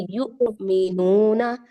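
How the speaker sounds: युमिनूना with a hard catch after यु; slow recited युमिनूना, abrupt break after यु; strong hamza jerk. Shown as a demonstration. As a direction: jolt to a stop on यु, then draw out मिनूना. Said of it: "हमजा साकिन का अच्छा सा झटका देंगे".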